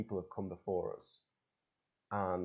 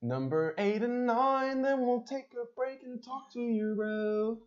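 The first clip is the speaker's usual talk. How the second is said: announcer voice; 4 dB louder, slower than usual. Acted. Calling out, sing-song announcer.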